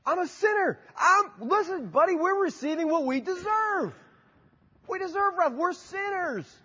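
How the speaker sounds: crying out as the repentant criminal; pleading confession. Retold or imitated. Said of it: "what's he confessing".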